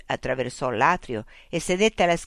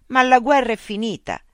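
exasperated protest; 8 semitones higher, 8 dB louder than usual. Acted. Mitch protests.

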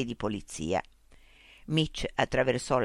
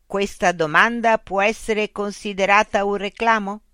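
machine voice; 5 semitones higher, 6 dB louder than usual. Acted. Loudspeaker robot voice addressing Mitch. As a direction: speak as a polite, mechanical information unit.

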